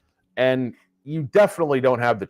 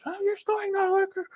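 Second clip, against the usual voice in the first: much higher mock wife's voice; wife scolding her snoring husband, high and mocking; 6 dB quieter, faster than usual. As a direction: squeak it like a nagging wife.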